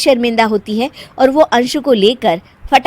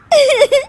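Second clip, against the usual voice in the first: stifled laugh; 5 dB louder than usual. Acted. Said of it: "वहां पर सब रो रहे होते हैं".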